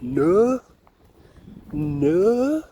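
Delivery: "No, no" with the second "no" leaping high; mock Yorkshire "no", stretched and sung up; Yorkshire way of saying "No, no". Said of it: "Yorkshire and that, they'll be like".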